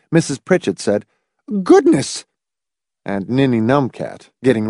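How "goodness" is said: high-pitched cross woman; cross exclamation. A narrator's reading. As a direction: exclaim as if nearly tripped up. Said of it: "Crossly, half stumbling".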